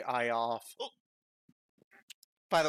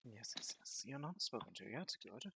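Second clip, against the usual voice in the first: hushed, whispered villain aside; menacing whisper; 9 dB quieter than usual. Acted. Villain to a captive not yet spoken to, whispering.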